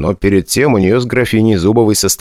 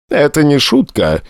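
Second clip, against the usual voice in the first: gloomy; 7 semitones higher than usual. A narrator's reading. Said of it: "мрачно ответила цыганка".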